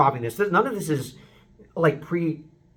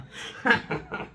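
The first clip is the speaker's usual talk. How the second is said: airy breathy scoffing laugh; dismissive laugh; 7 semitones higher, 6 dB quieter than usual. Shown as a demonstration. Vocal coach voicing indifference to a betrayer with a scoffing laugh. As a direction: laugh it off, unbothered. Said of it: "betrayed you and you don't care".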